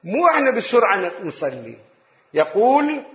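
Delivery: normal talking voice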